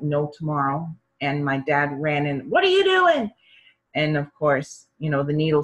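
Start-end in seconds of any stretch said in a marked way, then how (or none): 2.5-3.3 high, loud alarmed father; alarmed shout. Retold or imitated. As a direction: burst in yelling at the doctor. Dad bursts in alarmed.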